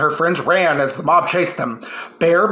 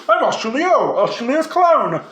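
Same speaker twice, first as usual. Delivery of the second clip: disguised voice; 6 semitones higher than usual. A narrator's reading. Australia disguised as clown.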